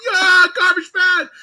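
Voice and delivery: high, slow, loud drawn-out yell; drunk fan yelling. Retold or imitated. Drunk fan cheering the garbage man, slow loud yell.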